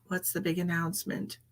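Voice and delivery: hushed rising question; whisper. Real, not acted. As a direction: whisper with a rise. Whispered repeat.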